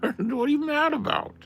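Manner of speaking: very high pet-talk voice; talking to the dog. Acted. Settling the dog onto its chair, very high doting pet voice. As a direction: coo at the dog way up high, sing-song.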